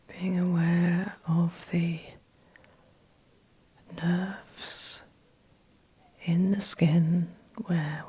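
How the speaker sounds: soft, breathy meditation voice with long pauses; guided meditation. Acted. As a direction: guide them gently back, phrase by phrase, leaving space. Guides client back into body.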